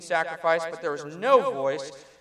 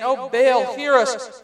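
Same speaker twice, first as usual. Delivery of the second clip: loud, raised plea to a god; calling out to Baal; 6 semitones higher, 10 dB louder, much slower than usual. Acted. Prophets of Baal calling out to their god, loud pleading cry. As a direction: cry out to the idol for an answer.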